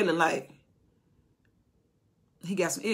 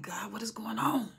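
whispered, higher confused cry; whispered bewilderment; 4 semitones higher, 7 dB quieter than usual. Retold or imitated. Soldiers after the battle wondering, whispered. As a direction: breathe it out as a baffled soldier.

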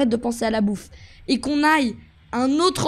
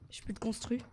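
softer, deflated mutter; 4 semitones lower, 16 dB quieter than usual. Real, not acted. Gamer out of materials, deflated mutter. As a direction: grumble it under your breath.

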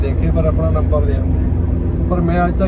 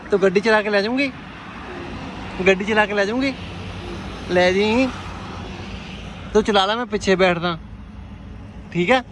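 higher pitch, rising playful questions; 4 semitones higher, 4 dB quieter than usual. Real, not acted. Driver jokingly asks a little girl to drive, child-directed voice.

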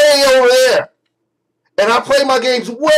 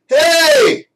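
shouting at the Kinect. Shown as a demonstration. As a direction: yell it to the mic way back there. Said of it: "let me give you a fucking example".

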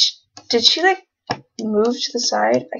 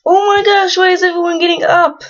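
high, loud frustrated cry; exasperated outcry; 8 semitones higher, 4 dB louder than usual. Real, not acted. Player annoyed that her Sims keep waking up, loud exasperated cry. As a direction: throw up your hands and shout it up high.

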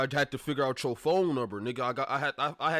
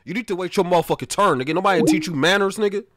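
higher, louder cut-in over the other person; raised-voice mock outrage at being interrupted; indignant scolding; 6 semitones higher, 12 dB louder than usual. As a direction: put on an irate caller telling someone off. Prank caller scolds interrupting coach.

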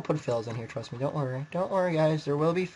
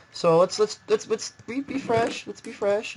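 perky restart; 7 semitones higher than usual. Real, not acted. Restarts after losing the game.

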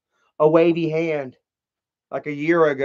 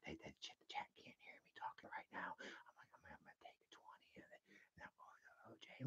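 hushed secret whisper; 6 semitones lower, 37 dB quieter, much faster than usual. Pretend. Tina sneaking a drug deal in a whisper. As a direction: whisper so chat can't hear. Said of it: "She was like".

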